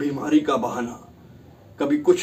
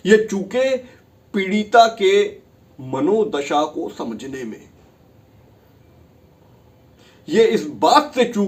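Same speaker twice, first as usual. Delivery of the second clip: louder, higher, slowing on key words; rising accusation; 5 semitones higher, 5 dB louder than usual. Acted. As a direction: hammer the charge home. Prosecutor's accusation before the judge.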